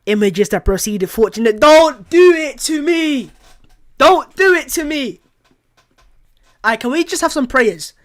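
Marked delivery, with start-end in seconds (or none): 1.6-5.2 higher, louder, slow plea; anguished plea. Real, not acted. Fan pleading with fate over the injury.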